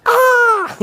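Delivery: high mock shriek of a startled spectator; hammed-up high cry; imitated cry. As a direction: squeal like the shocked spectator. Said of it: "always cry".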